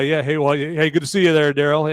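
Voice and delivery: ordinary delivery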